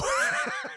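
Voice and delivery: loud burst of laughter; laughing. Real, not acted. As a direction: crack up suddenly. Cracks up before the secret.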